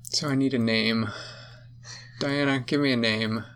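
ordinary delivery, nothing marked

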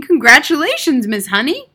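loud, high congratulation; grinning, exuberant praise; jubilant cry. A narrator's reading. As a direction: cheer her heartily. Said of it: "cried one of the men".

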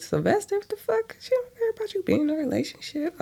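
high, fast disbelieving squeal; incredulous squeal. Real, not acted. Narrator mocking the character's line in disbelief. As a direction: squeal it in mock outrage. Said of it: "'cause I'm like".

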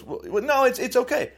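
other men's excuse. Retold or imitated. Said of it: "other men goes".

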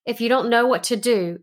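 ordinary talk, nothing marked

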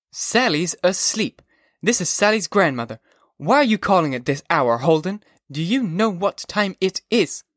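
put-on an old woman annoyed at a late-night call; stern grandmother. A narrator's reading. Grandmother scolds Holden for calling so late.

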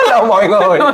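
loud, high, laughing hello; cheery stage greeting through laughter; mock comedian greeting. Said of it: "Xong Phương Nam lên".

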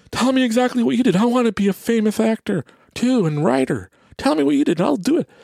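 mocking eager fans. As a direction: parody the annoying questioner begging for a recipe. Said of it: "everybody that asked the question is like".

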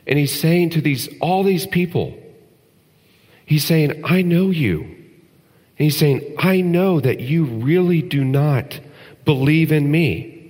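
high, rising sermon fervour; preacher building to a passionate peak; impassioned preaching. Acted. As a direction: press the point home with conviction. Impassioned preaching on Judas' betrayal.

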